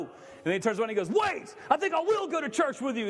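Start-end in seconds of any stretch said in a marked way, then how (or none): 1.1-3.1 mock shout. Big guy in the skit, a hammed-up shouted turnaround. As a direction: shout it like you've been struck.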